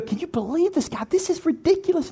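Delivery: high, hushed incredulous complaint; exasperated aside. Acted. Husband complaining to wife about neighbor, exasperated aside.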